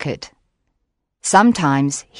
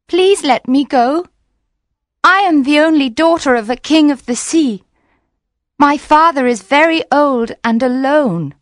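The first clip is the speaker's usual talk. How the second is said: higher, louder mermaid voice, a touch tearful; pleading mermaid; 8 semitones higher, 4 dB louder than usual. Acted. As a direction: beg to be set free, high and urgent. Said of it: "so she began to cry and said".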